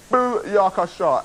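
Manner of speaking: slow, high showy catchphrase shout-out; Malik's catchphrase. Retold or imitated. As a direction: imitate a friend's hype catchphrase. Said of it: "as Malik would say".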